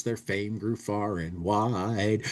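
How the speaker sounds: ordinary talk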